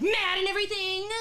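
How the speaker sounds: loud, high burst of demonstrated anger; hammed-up angry outburst; showing mad. Shown as a demonstration. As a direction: act mad on demand, jumping up in pitch and volume. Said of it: "if you want me to be".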